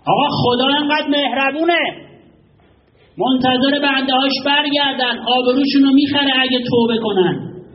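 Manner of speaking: high, loud, slow preaching cry; impassioned preaching. Acted. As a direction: lift the voice to move the crowd. Preaching at full force. (موعظهٔ پرشور، بلند و کشیده)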